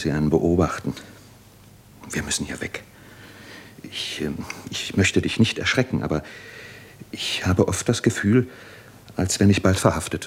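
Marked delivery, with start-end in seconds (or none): 2.1-4.4 softer, low and urgent; hushed urgency. Acted. Jura quietly warning Lara they must leave. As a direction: say it so nobody overhears.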